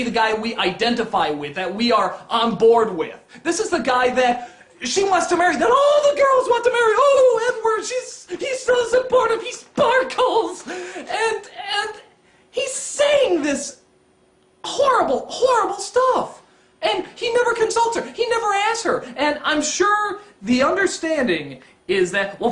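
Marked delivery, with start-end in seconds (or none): 3.4-10.7 high, gushing squeal; hammed-up adoration, dragged out at the end; mock swooning fangirl. Mocks adoring fans of Edward. 10.7-19.0 high-pitched, wailing complaint; comic despair, voice pushed way up; exasperated near-wail; distraught indignation. Real, not acted. Distraught rant about Edward.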